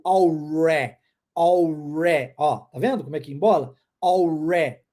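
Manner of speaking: "alre" with the tongue curled back on the R, slow and deliberate; rolled tongue R. Shown as a demonstration. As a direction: embole a língua no R de "alre". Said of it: "Eu vou pronunciar o R embolando a língua". ("alre" com o R enrolado, a língua embolada)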